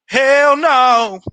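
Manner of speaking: a sudden loud high refusal; mock outburst. Tenants shouting at the landlord, mock outburst. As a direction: blurt it out, half joking. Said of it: "They was like".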